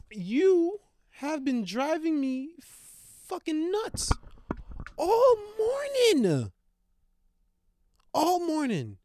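high, drawn-out furious wail; exasperated yell. Real, not acted. Fed up with the dog all morning, wailing. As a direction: stretch all morning out in disbelief.

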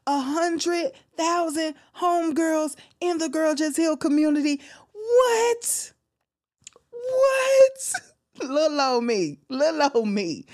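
high voice breaking with overwhelmed gratitude; emotional, near tears. Real, not acted. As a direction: cry through the thanks, pitch climbing in disbelief. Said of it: "I get emotional".

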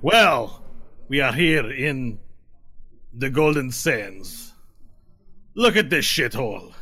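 put-on loud, slow, drawling drunk swagger; drunk tiefling. Rowdy tiefling sneering at the bar. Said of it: "And they're like".

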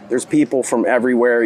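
usual speaking voice

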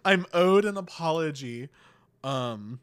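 mock indignant Kate. Retelling Kate demanding apology from Megan, haughty. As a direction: demand the apology like a wounded royal. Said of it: "Me as fuck".

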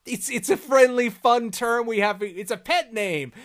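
high, loud, stretched-out excuses; Maul backpedalling. Acted. Maul explaining a pet name to Sidious, backpedalling. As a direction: talk your way out in a comic squeal.

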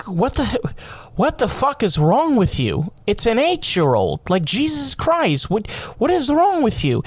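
high, incredulous outrage; outraged retort. Retold or imitated. Podcaster voicing his own reaction, high incredulous outrage. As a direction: react like it's insane. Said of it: "I'm like".

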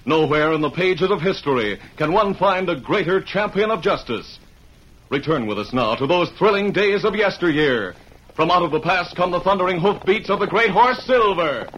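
grand, rising announcer read; announcer intro. Acted. The announcer opens the western serial with rising grand narration. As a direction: build the thrill of yesteryear. Announcer's opening over music.

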